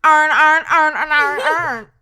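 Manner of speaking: loud, exaggerated Baltimore accent, every word as "urn". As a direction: mimic thick Baltimore, vowels all merged. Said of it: "if you have a Baltimore accent".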